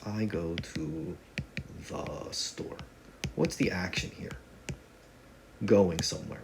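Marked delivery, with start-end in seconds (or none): 0.1-2.8 slow, soft, fading to a whisper; drawn out and dropping into a whisper; slow hushed repeat. Shown as a demonstration. Teacher repeating the example to students, slow and hushed. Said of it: "The subject is the person doing".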